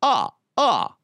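counting like the Count, with a cackling "ah, ah, ah"; Transylvanian accent. Acted. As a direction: Count von Count voice, hitting the numbers. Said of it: "get the Count voice from Sesame Street".

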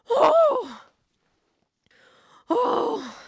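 high, stunned oh; shocked gasp. Real, not acted. Vlogger stunned by the cliffhanger, high shocked oh. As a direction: react in disbelief.

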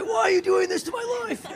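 high, wailing mock tantrum; hammed-up outburst of teenage-style despair; raging. Raging alone in the driveway, mock wail. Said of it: "raging in the car".

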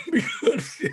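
laughing through the word, high. Real, not acted. Grandfather laughs recalling grandkids eating his pie, high laughter. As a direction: break into real laughter mid-sentence.

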